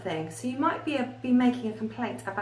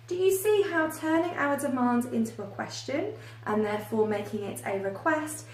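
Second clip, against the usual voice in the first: usual speaking voice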